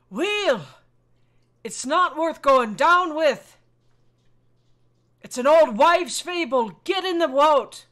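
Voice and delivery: loud, high call to a crewmate; urgent shout. Acted. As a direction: holler it slowly, ordering them into the boat. Urging crewman aboard sinking ship.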